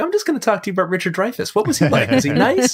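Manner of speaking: mock starstruck pestering, rushing; overeager fan. Retold eager fan questioning.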